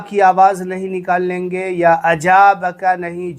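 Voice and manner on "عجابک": عجابک with j in place of ذ; mispronounced zaal. Shown as a demonstration. Teacher shows the wrong reading, عجابک with a j. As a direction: say عجابک with a clear jeem. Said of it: "جیم کی آواز بھی نہیں".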